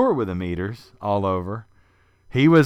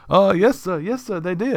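a hint of a higher humble voice; lightly voiced old man; 8 semitones higher than usual. Fess answers Quint politely in a slightly higher voice. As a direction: answer politely, a little high. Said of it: "he said".

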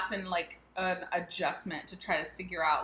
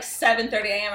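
normal talking voice

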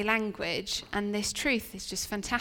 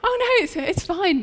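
high and louder, laughing through it; laughing reassurance; 10 semitones higher, 6 dB louder than usual. Retold or imitated. Staff reassuring the mum after the prayer, laughing high. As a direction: brush it off with a laugh. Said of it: "and we were like".

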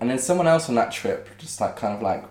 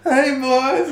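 very high, loud faraway hail; hammed-up distant come-on; distant beach woman; 7 dB louder than usual. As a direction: imitate her calling from down the beach. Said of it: "hear her in the distance".

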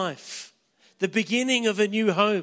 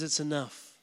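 quiet final word; 6 semitones lower, 10 dB quieter than usual. Acted. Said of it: "he says".

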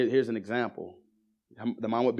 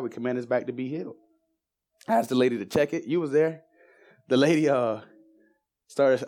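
higher and louder retelling of the prayer; lively preaching retell; 3 semitones higher, 3 dB louder than usual. Acted. Preacher recounts praying for the woman's back, raised and fervent. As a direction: tell it with rising preacher energy.

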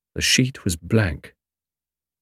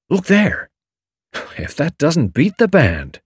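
excited; 7 semitones higher, slower than usual. A narrator's reading. Julius sees the rock.

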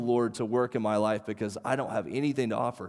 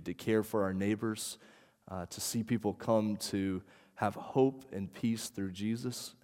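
soft, lowered prayer voice; 4 semitones lower, 7 dB quieter than usual. Acted. Pastor praying for the church before teaching, soft prayer voice. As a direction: pray quietly over the congregation.

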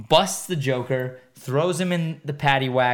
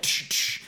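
sharp voiceless "tsh" bursts; imitated fight noises; 5 dB quieter than usual. Comedian making Batman's elbow-strike noises, hissed effects. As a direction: hiss out two quick sound-effect hits. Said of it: "Batman's like".

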